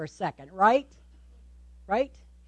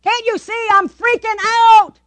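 high, louder exasperated cry; mock freak-out; 8 semitones higher, 5 dB louder than usual. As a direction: plead loudly as if freaking out. Acted frantic cry to God.

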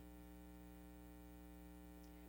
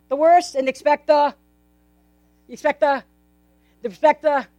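usual speaking voice

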